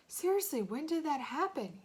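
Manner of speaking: plain reading voice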